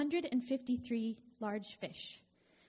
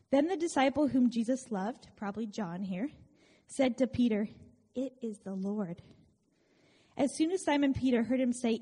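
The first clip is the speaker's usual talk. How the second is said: louder Bible reading to the congregation; preacher reading the gospel aloud to the church; scripture reading; 6 dB louder than usual. Acted. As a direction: read the passage out with weight. Reads the Bible passage aloud.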